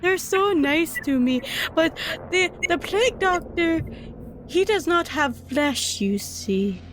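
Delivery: high, hungry monster voice; creepy creature voice. Acted. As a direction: purr it like a greedy beast.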